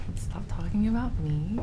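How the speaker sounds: playful stage whisper. Pretend. Jokingly changes topic by whispering.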